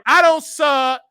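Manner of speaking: loud, high, defiant statement; no whispers. Real, not acted. Host telling caller they don't sub, loud and defiant. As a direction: belt it out in emphatic bursts. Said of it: "I don't do no whispers".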